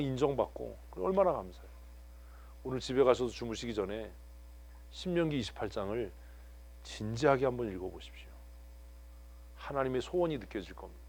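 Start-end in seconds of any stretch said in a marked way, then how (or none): none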